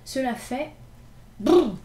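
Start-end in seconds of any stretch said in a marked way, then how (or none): 1.4-1.8 loud shivering brrr. Acted. Gavroche shivering after giving away his scarf. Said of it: "dit Gavroche".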